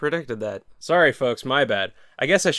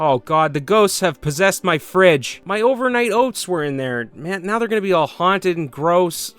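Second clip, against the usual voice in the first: mock panic; 6 semitones higher than usual. Mock horror at a haunted fridge.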